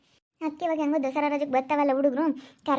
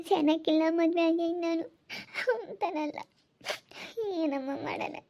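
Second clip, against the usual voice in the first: crying, higher-pitched voice; tearful lament; 4 semitones higher than usual. Real, not acted. Wife pouring out her married misery to women consoling her, tearful. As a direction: lament your lot through tears. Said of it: "ಅಳ್ಬೇಡ ಸುಮ್ನಿರಕ್ಕ".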